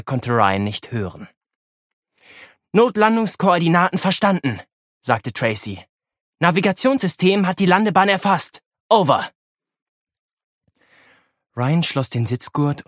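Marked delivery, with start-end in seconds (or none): 2.7-9.3 radio call. Acted. Pilot confirms over radio.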